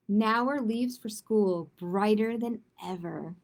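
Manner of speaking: put-on gentle read-aloud for children; story narration. A narrator's reading. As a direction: read it like a bedtime picture book. Reads a picture book aloud.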